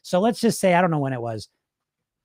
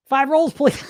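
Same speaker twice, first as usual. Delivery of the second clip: panicky high order; 9 semitones higher, slower than usual. Retold or imitated. Caving in again, ordering five.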